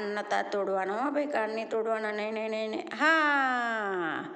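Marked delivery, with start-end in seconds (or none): 3.0-4.2 echoed loud yes. Shown as a demonstration. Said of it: "વાહ ભાઈ વાહ".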